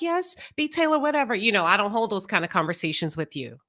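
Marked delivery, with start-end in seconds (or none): 0.0-1.3 mock cheerleading. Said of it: "I'm not here to be like".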